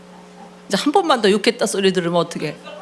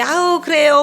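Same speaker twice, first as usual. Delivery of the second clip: higher-pitched, mock-pious agreement; outwardly holy act; 8 semitones higher, 5 dB louder than usual. Pastor imitating two-faced believers, sanctimonious put-on voice. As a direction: put on a saintly front in a raised voice. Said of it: "겉으로는 거룩하게".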